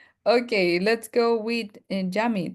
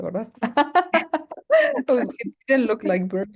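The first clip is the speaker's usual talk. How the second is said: ordinary talk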